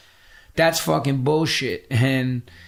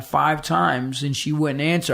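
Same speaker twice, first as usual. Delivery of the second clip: ordinary delivery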